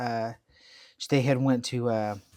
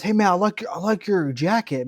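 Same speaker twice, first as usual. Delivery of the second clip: higher-pitched, lightly voiced eager kid; imitated admiring kid; 6 semitones higher than usual. Acts out admiring kid in anecdote.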